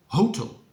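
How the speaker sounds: hotel with the stress on HO, first syllable; wrong stress on first syllable. Shown as a demonstration. Teacher showing learners a wrong hotel. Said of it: "That's incorrect".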